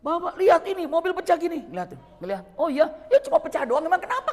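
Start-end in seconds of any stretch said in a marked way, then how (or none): 0.0-1.7 loud, high urging to look at the damage; urgent plea. Retold or imitated. Urging sheikh to see damage. 2.5-4.3 loud, high, breezy dismissal; unbothered shrug. Retold or imitated. Sheikh shrugs off damage.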